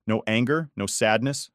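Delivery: usual speaking voice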